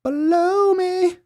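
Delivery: very high, slow, loud squeal; squeaky high wail. Acted. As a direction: stretch it into a falsetto squeal. High sung exclamation.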